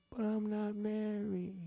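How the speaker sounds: high, drawn-out whine; whining protest. Acted. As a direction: plead it high and slow, like an excuse. Acted protest to the prophet.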